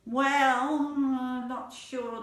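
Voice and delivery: high, long drawn-out doubtful "well"; reluctant. Acted. Animal asked to let spider climb up, reluctant. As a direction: hedge slowly, stretching the words. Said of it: "everybody was a little bit reluctant".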